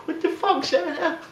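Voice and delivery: put-on very high squeaky kid voice; terrified little girl. Little girl on Santa's lap, shrieking high and scared. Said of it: "And she's like".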